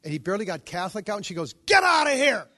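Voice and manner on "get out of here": loud, high-pitched shouted order; furious retold yell. Angry patient yelling at a visitor, loud retold shout. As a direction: yell it to throw someone out. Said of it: "She yelled at him".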